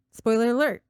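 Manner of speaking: ordinary delivery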